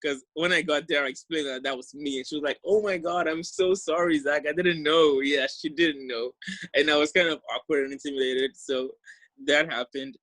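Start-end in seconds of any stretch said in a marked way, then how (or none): 2.6-5.2 higher-pitched imitation of a gushing apology; apologetic. Host mom apologising to Zach once he explained, retold apologetically. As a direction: retell her apology with raised pitch.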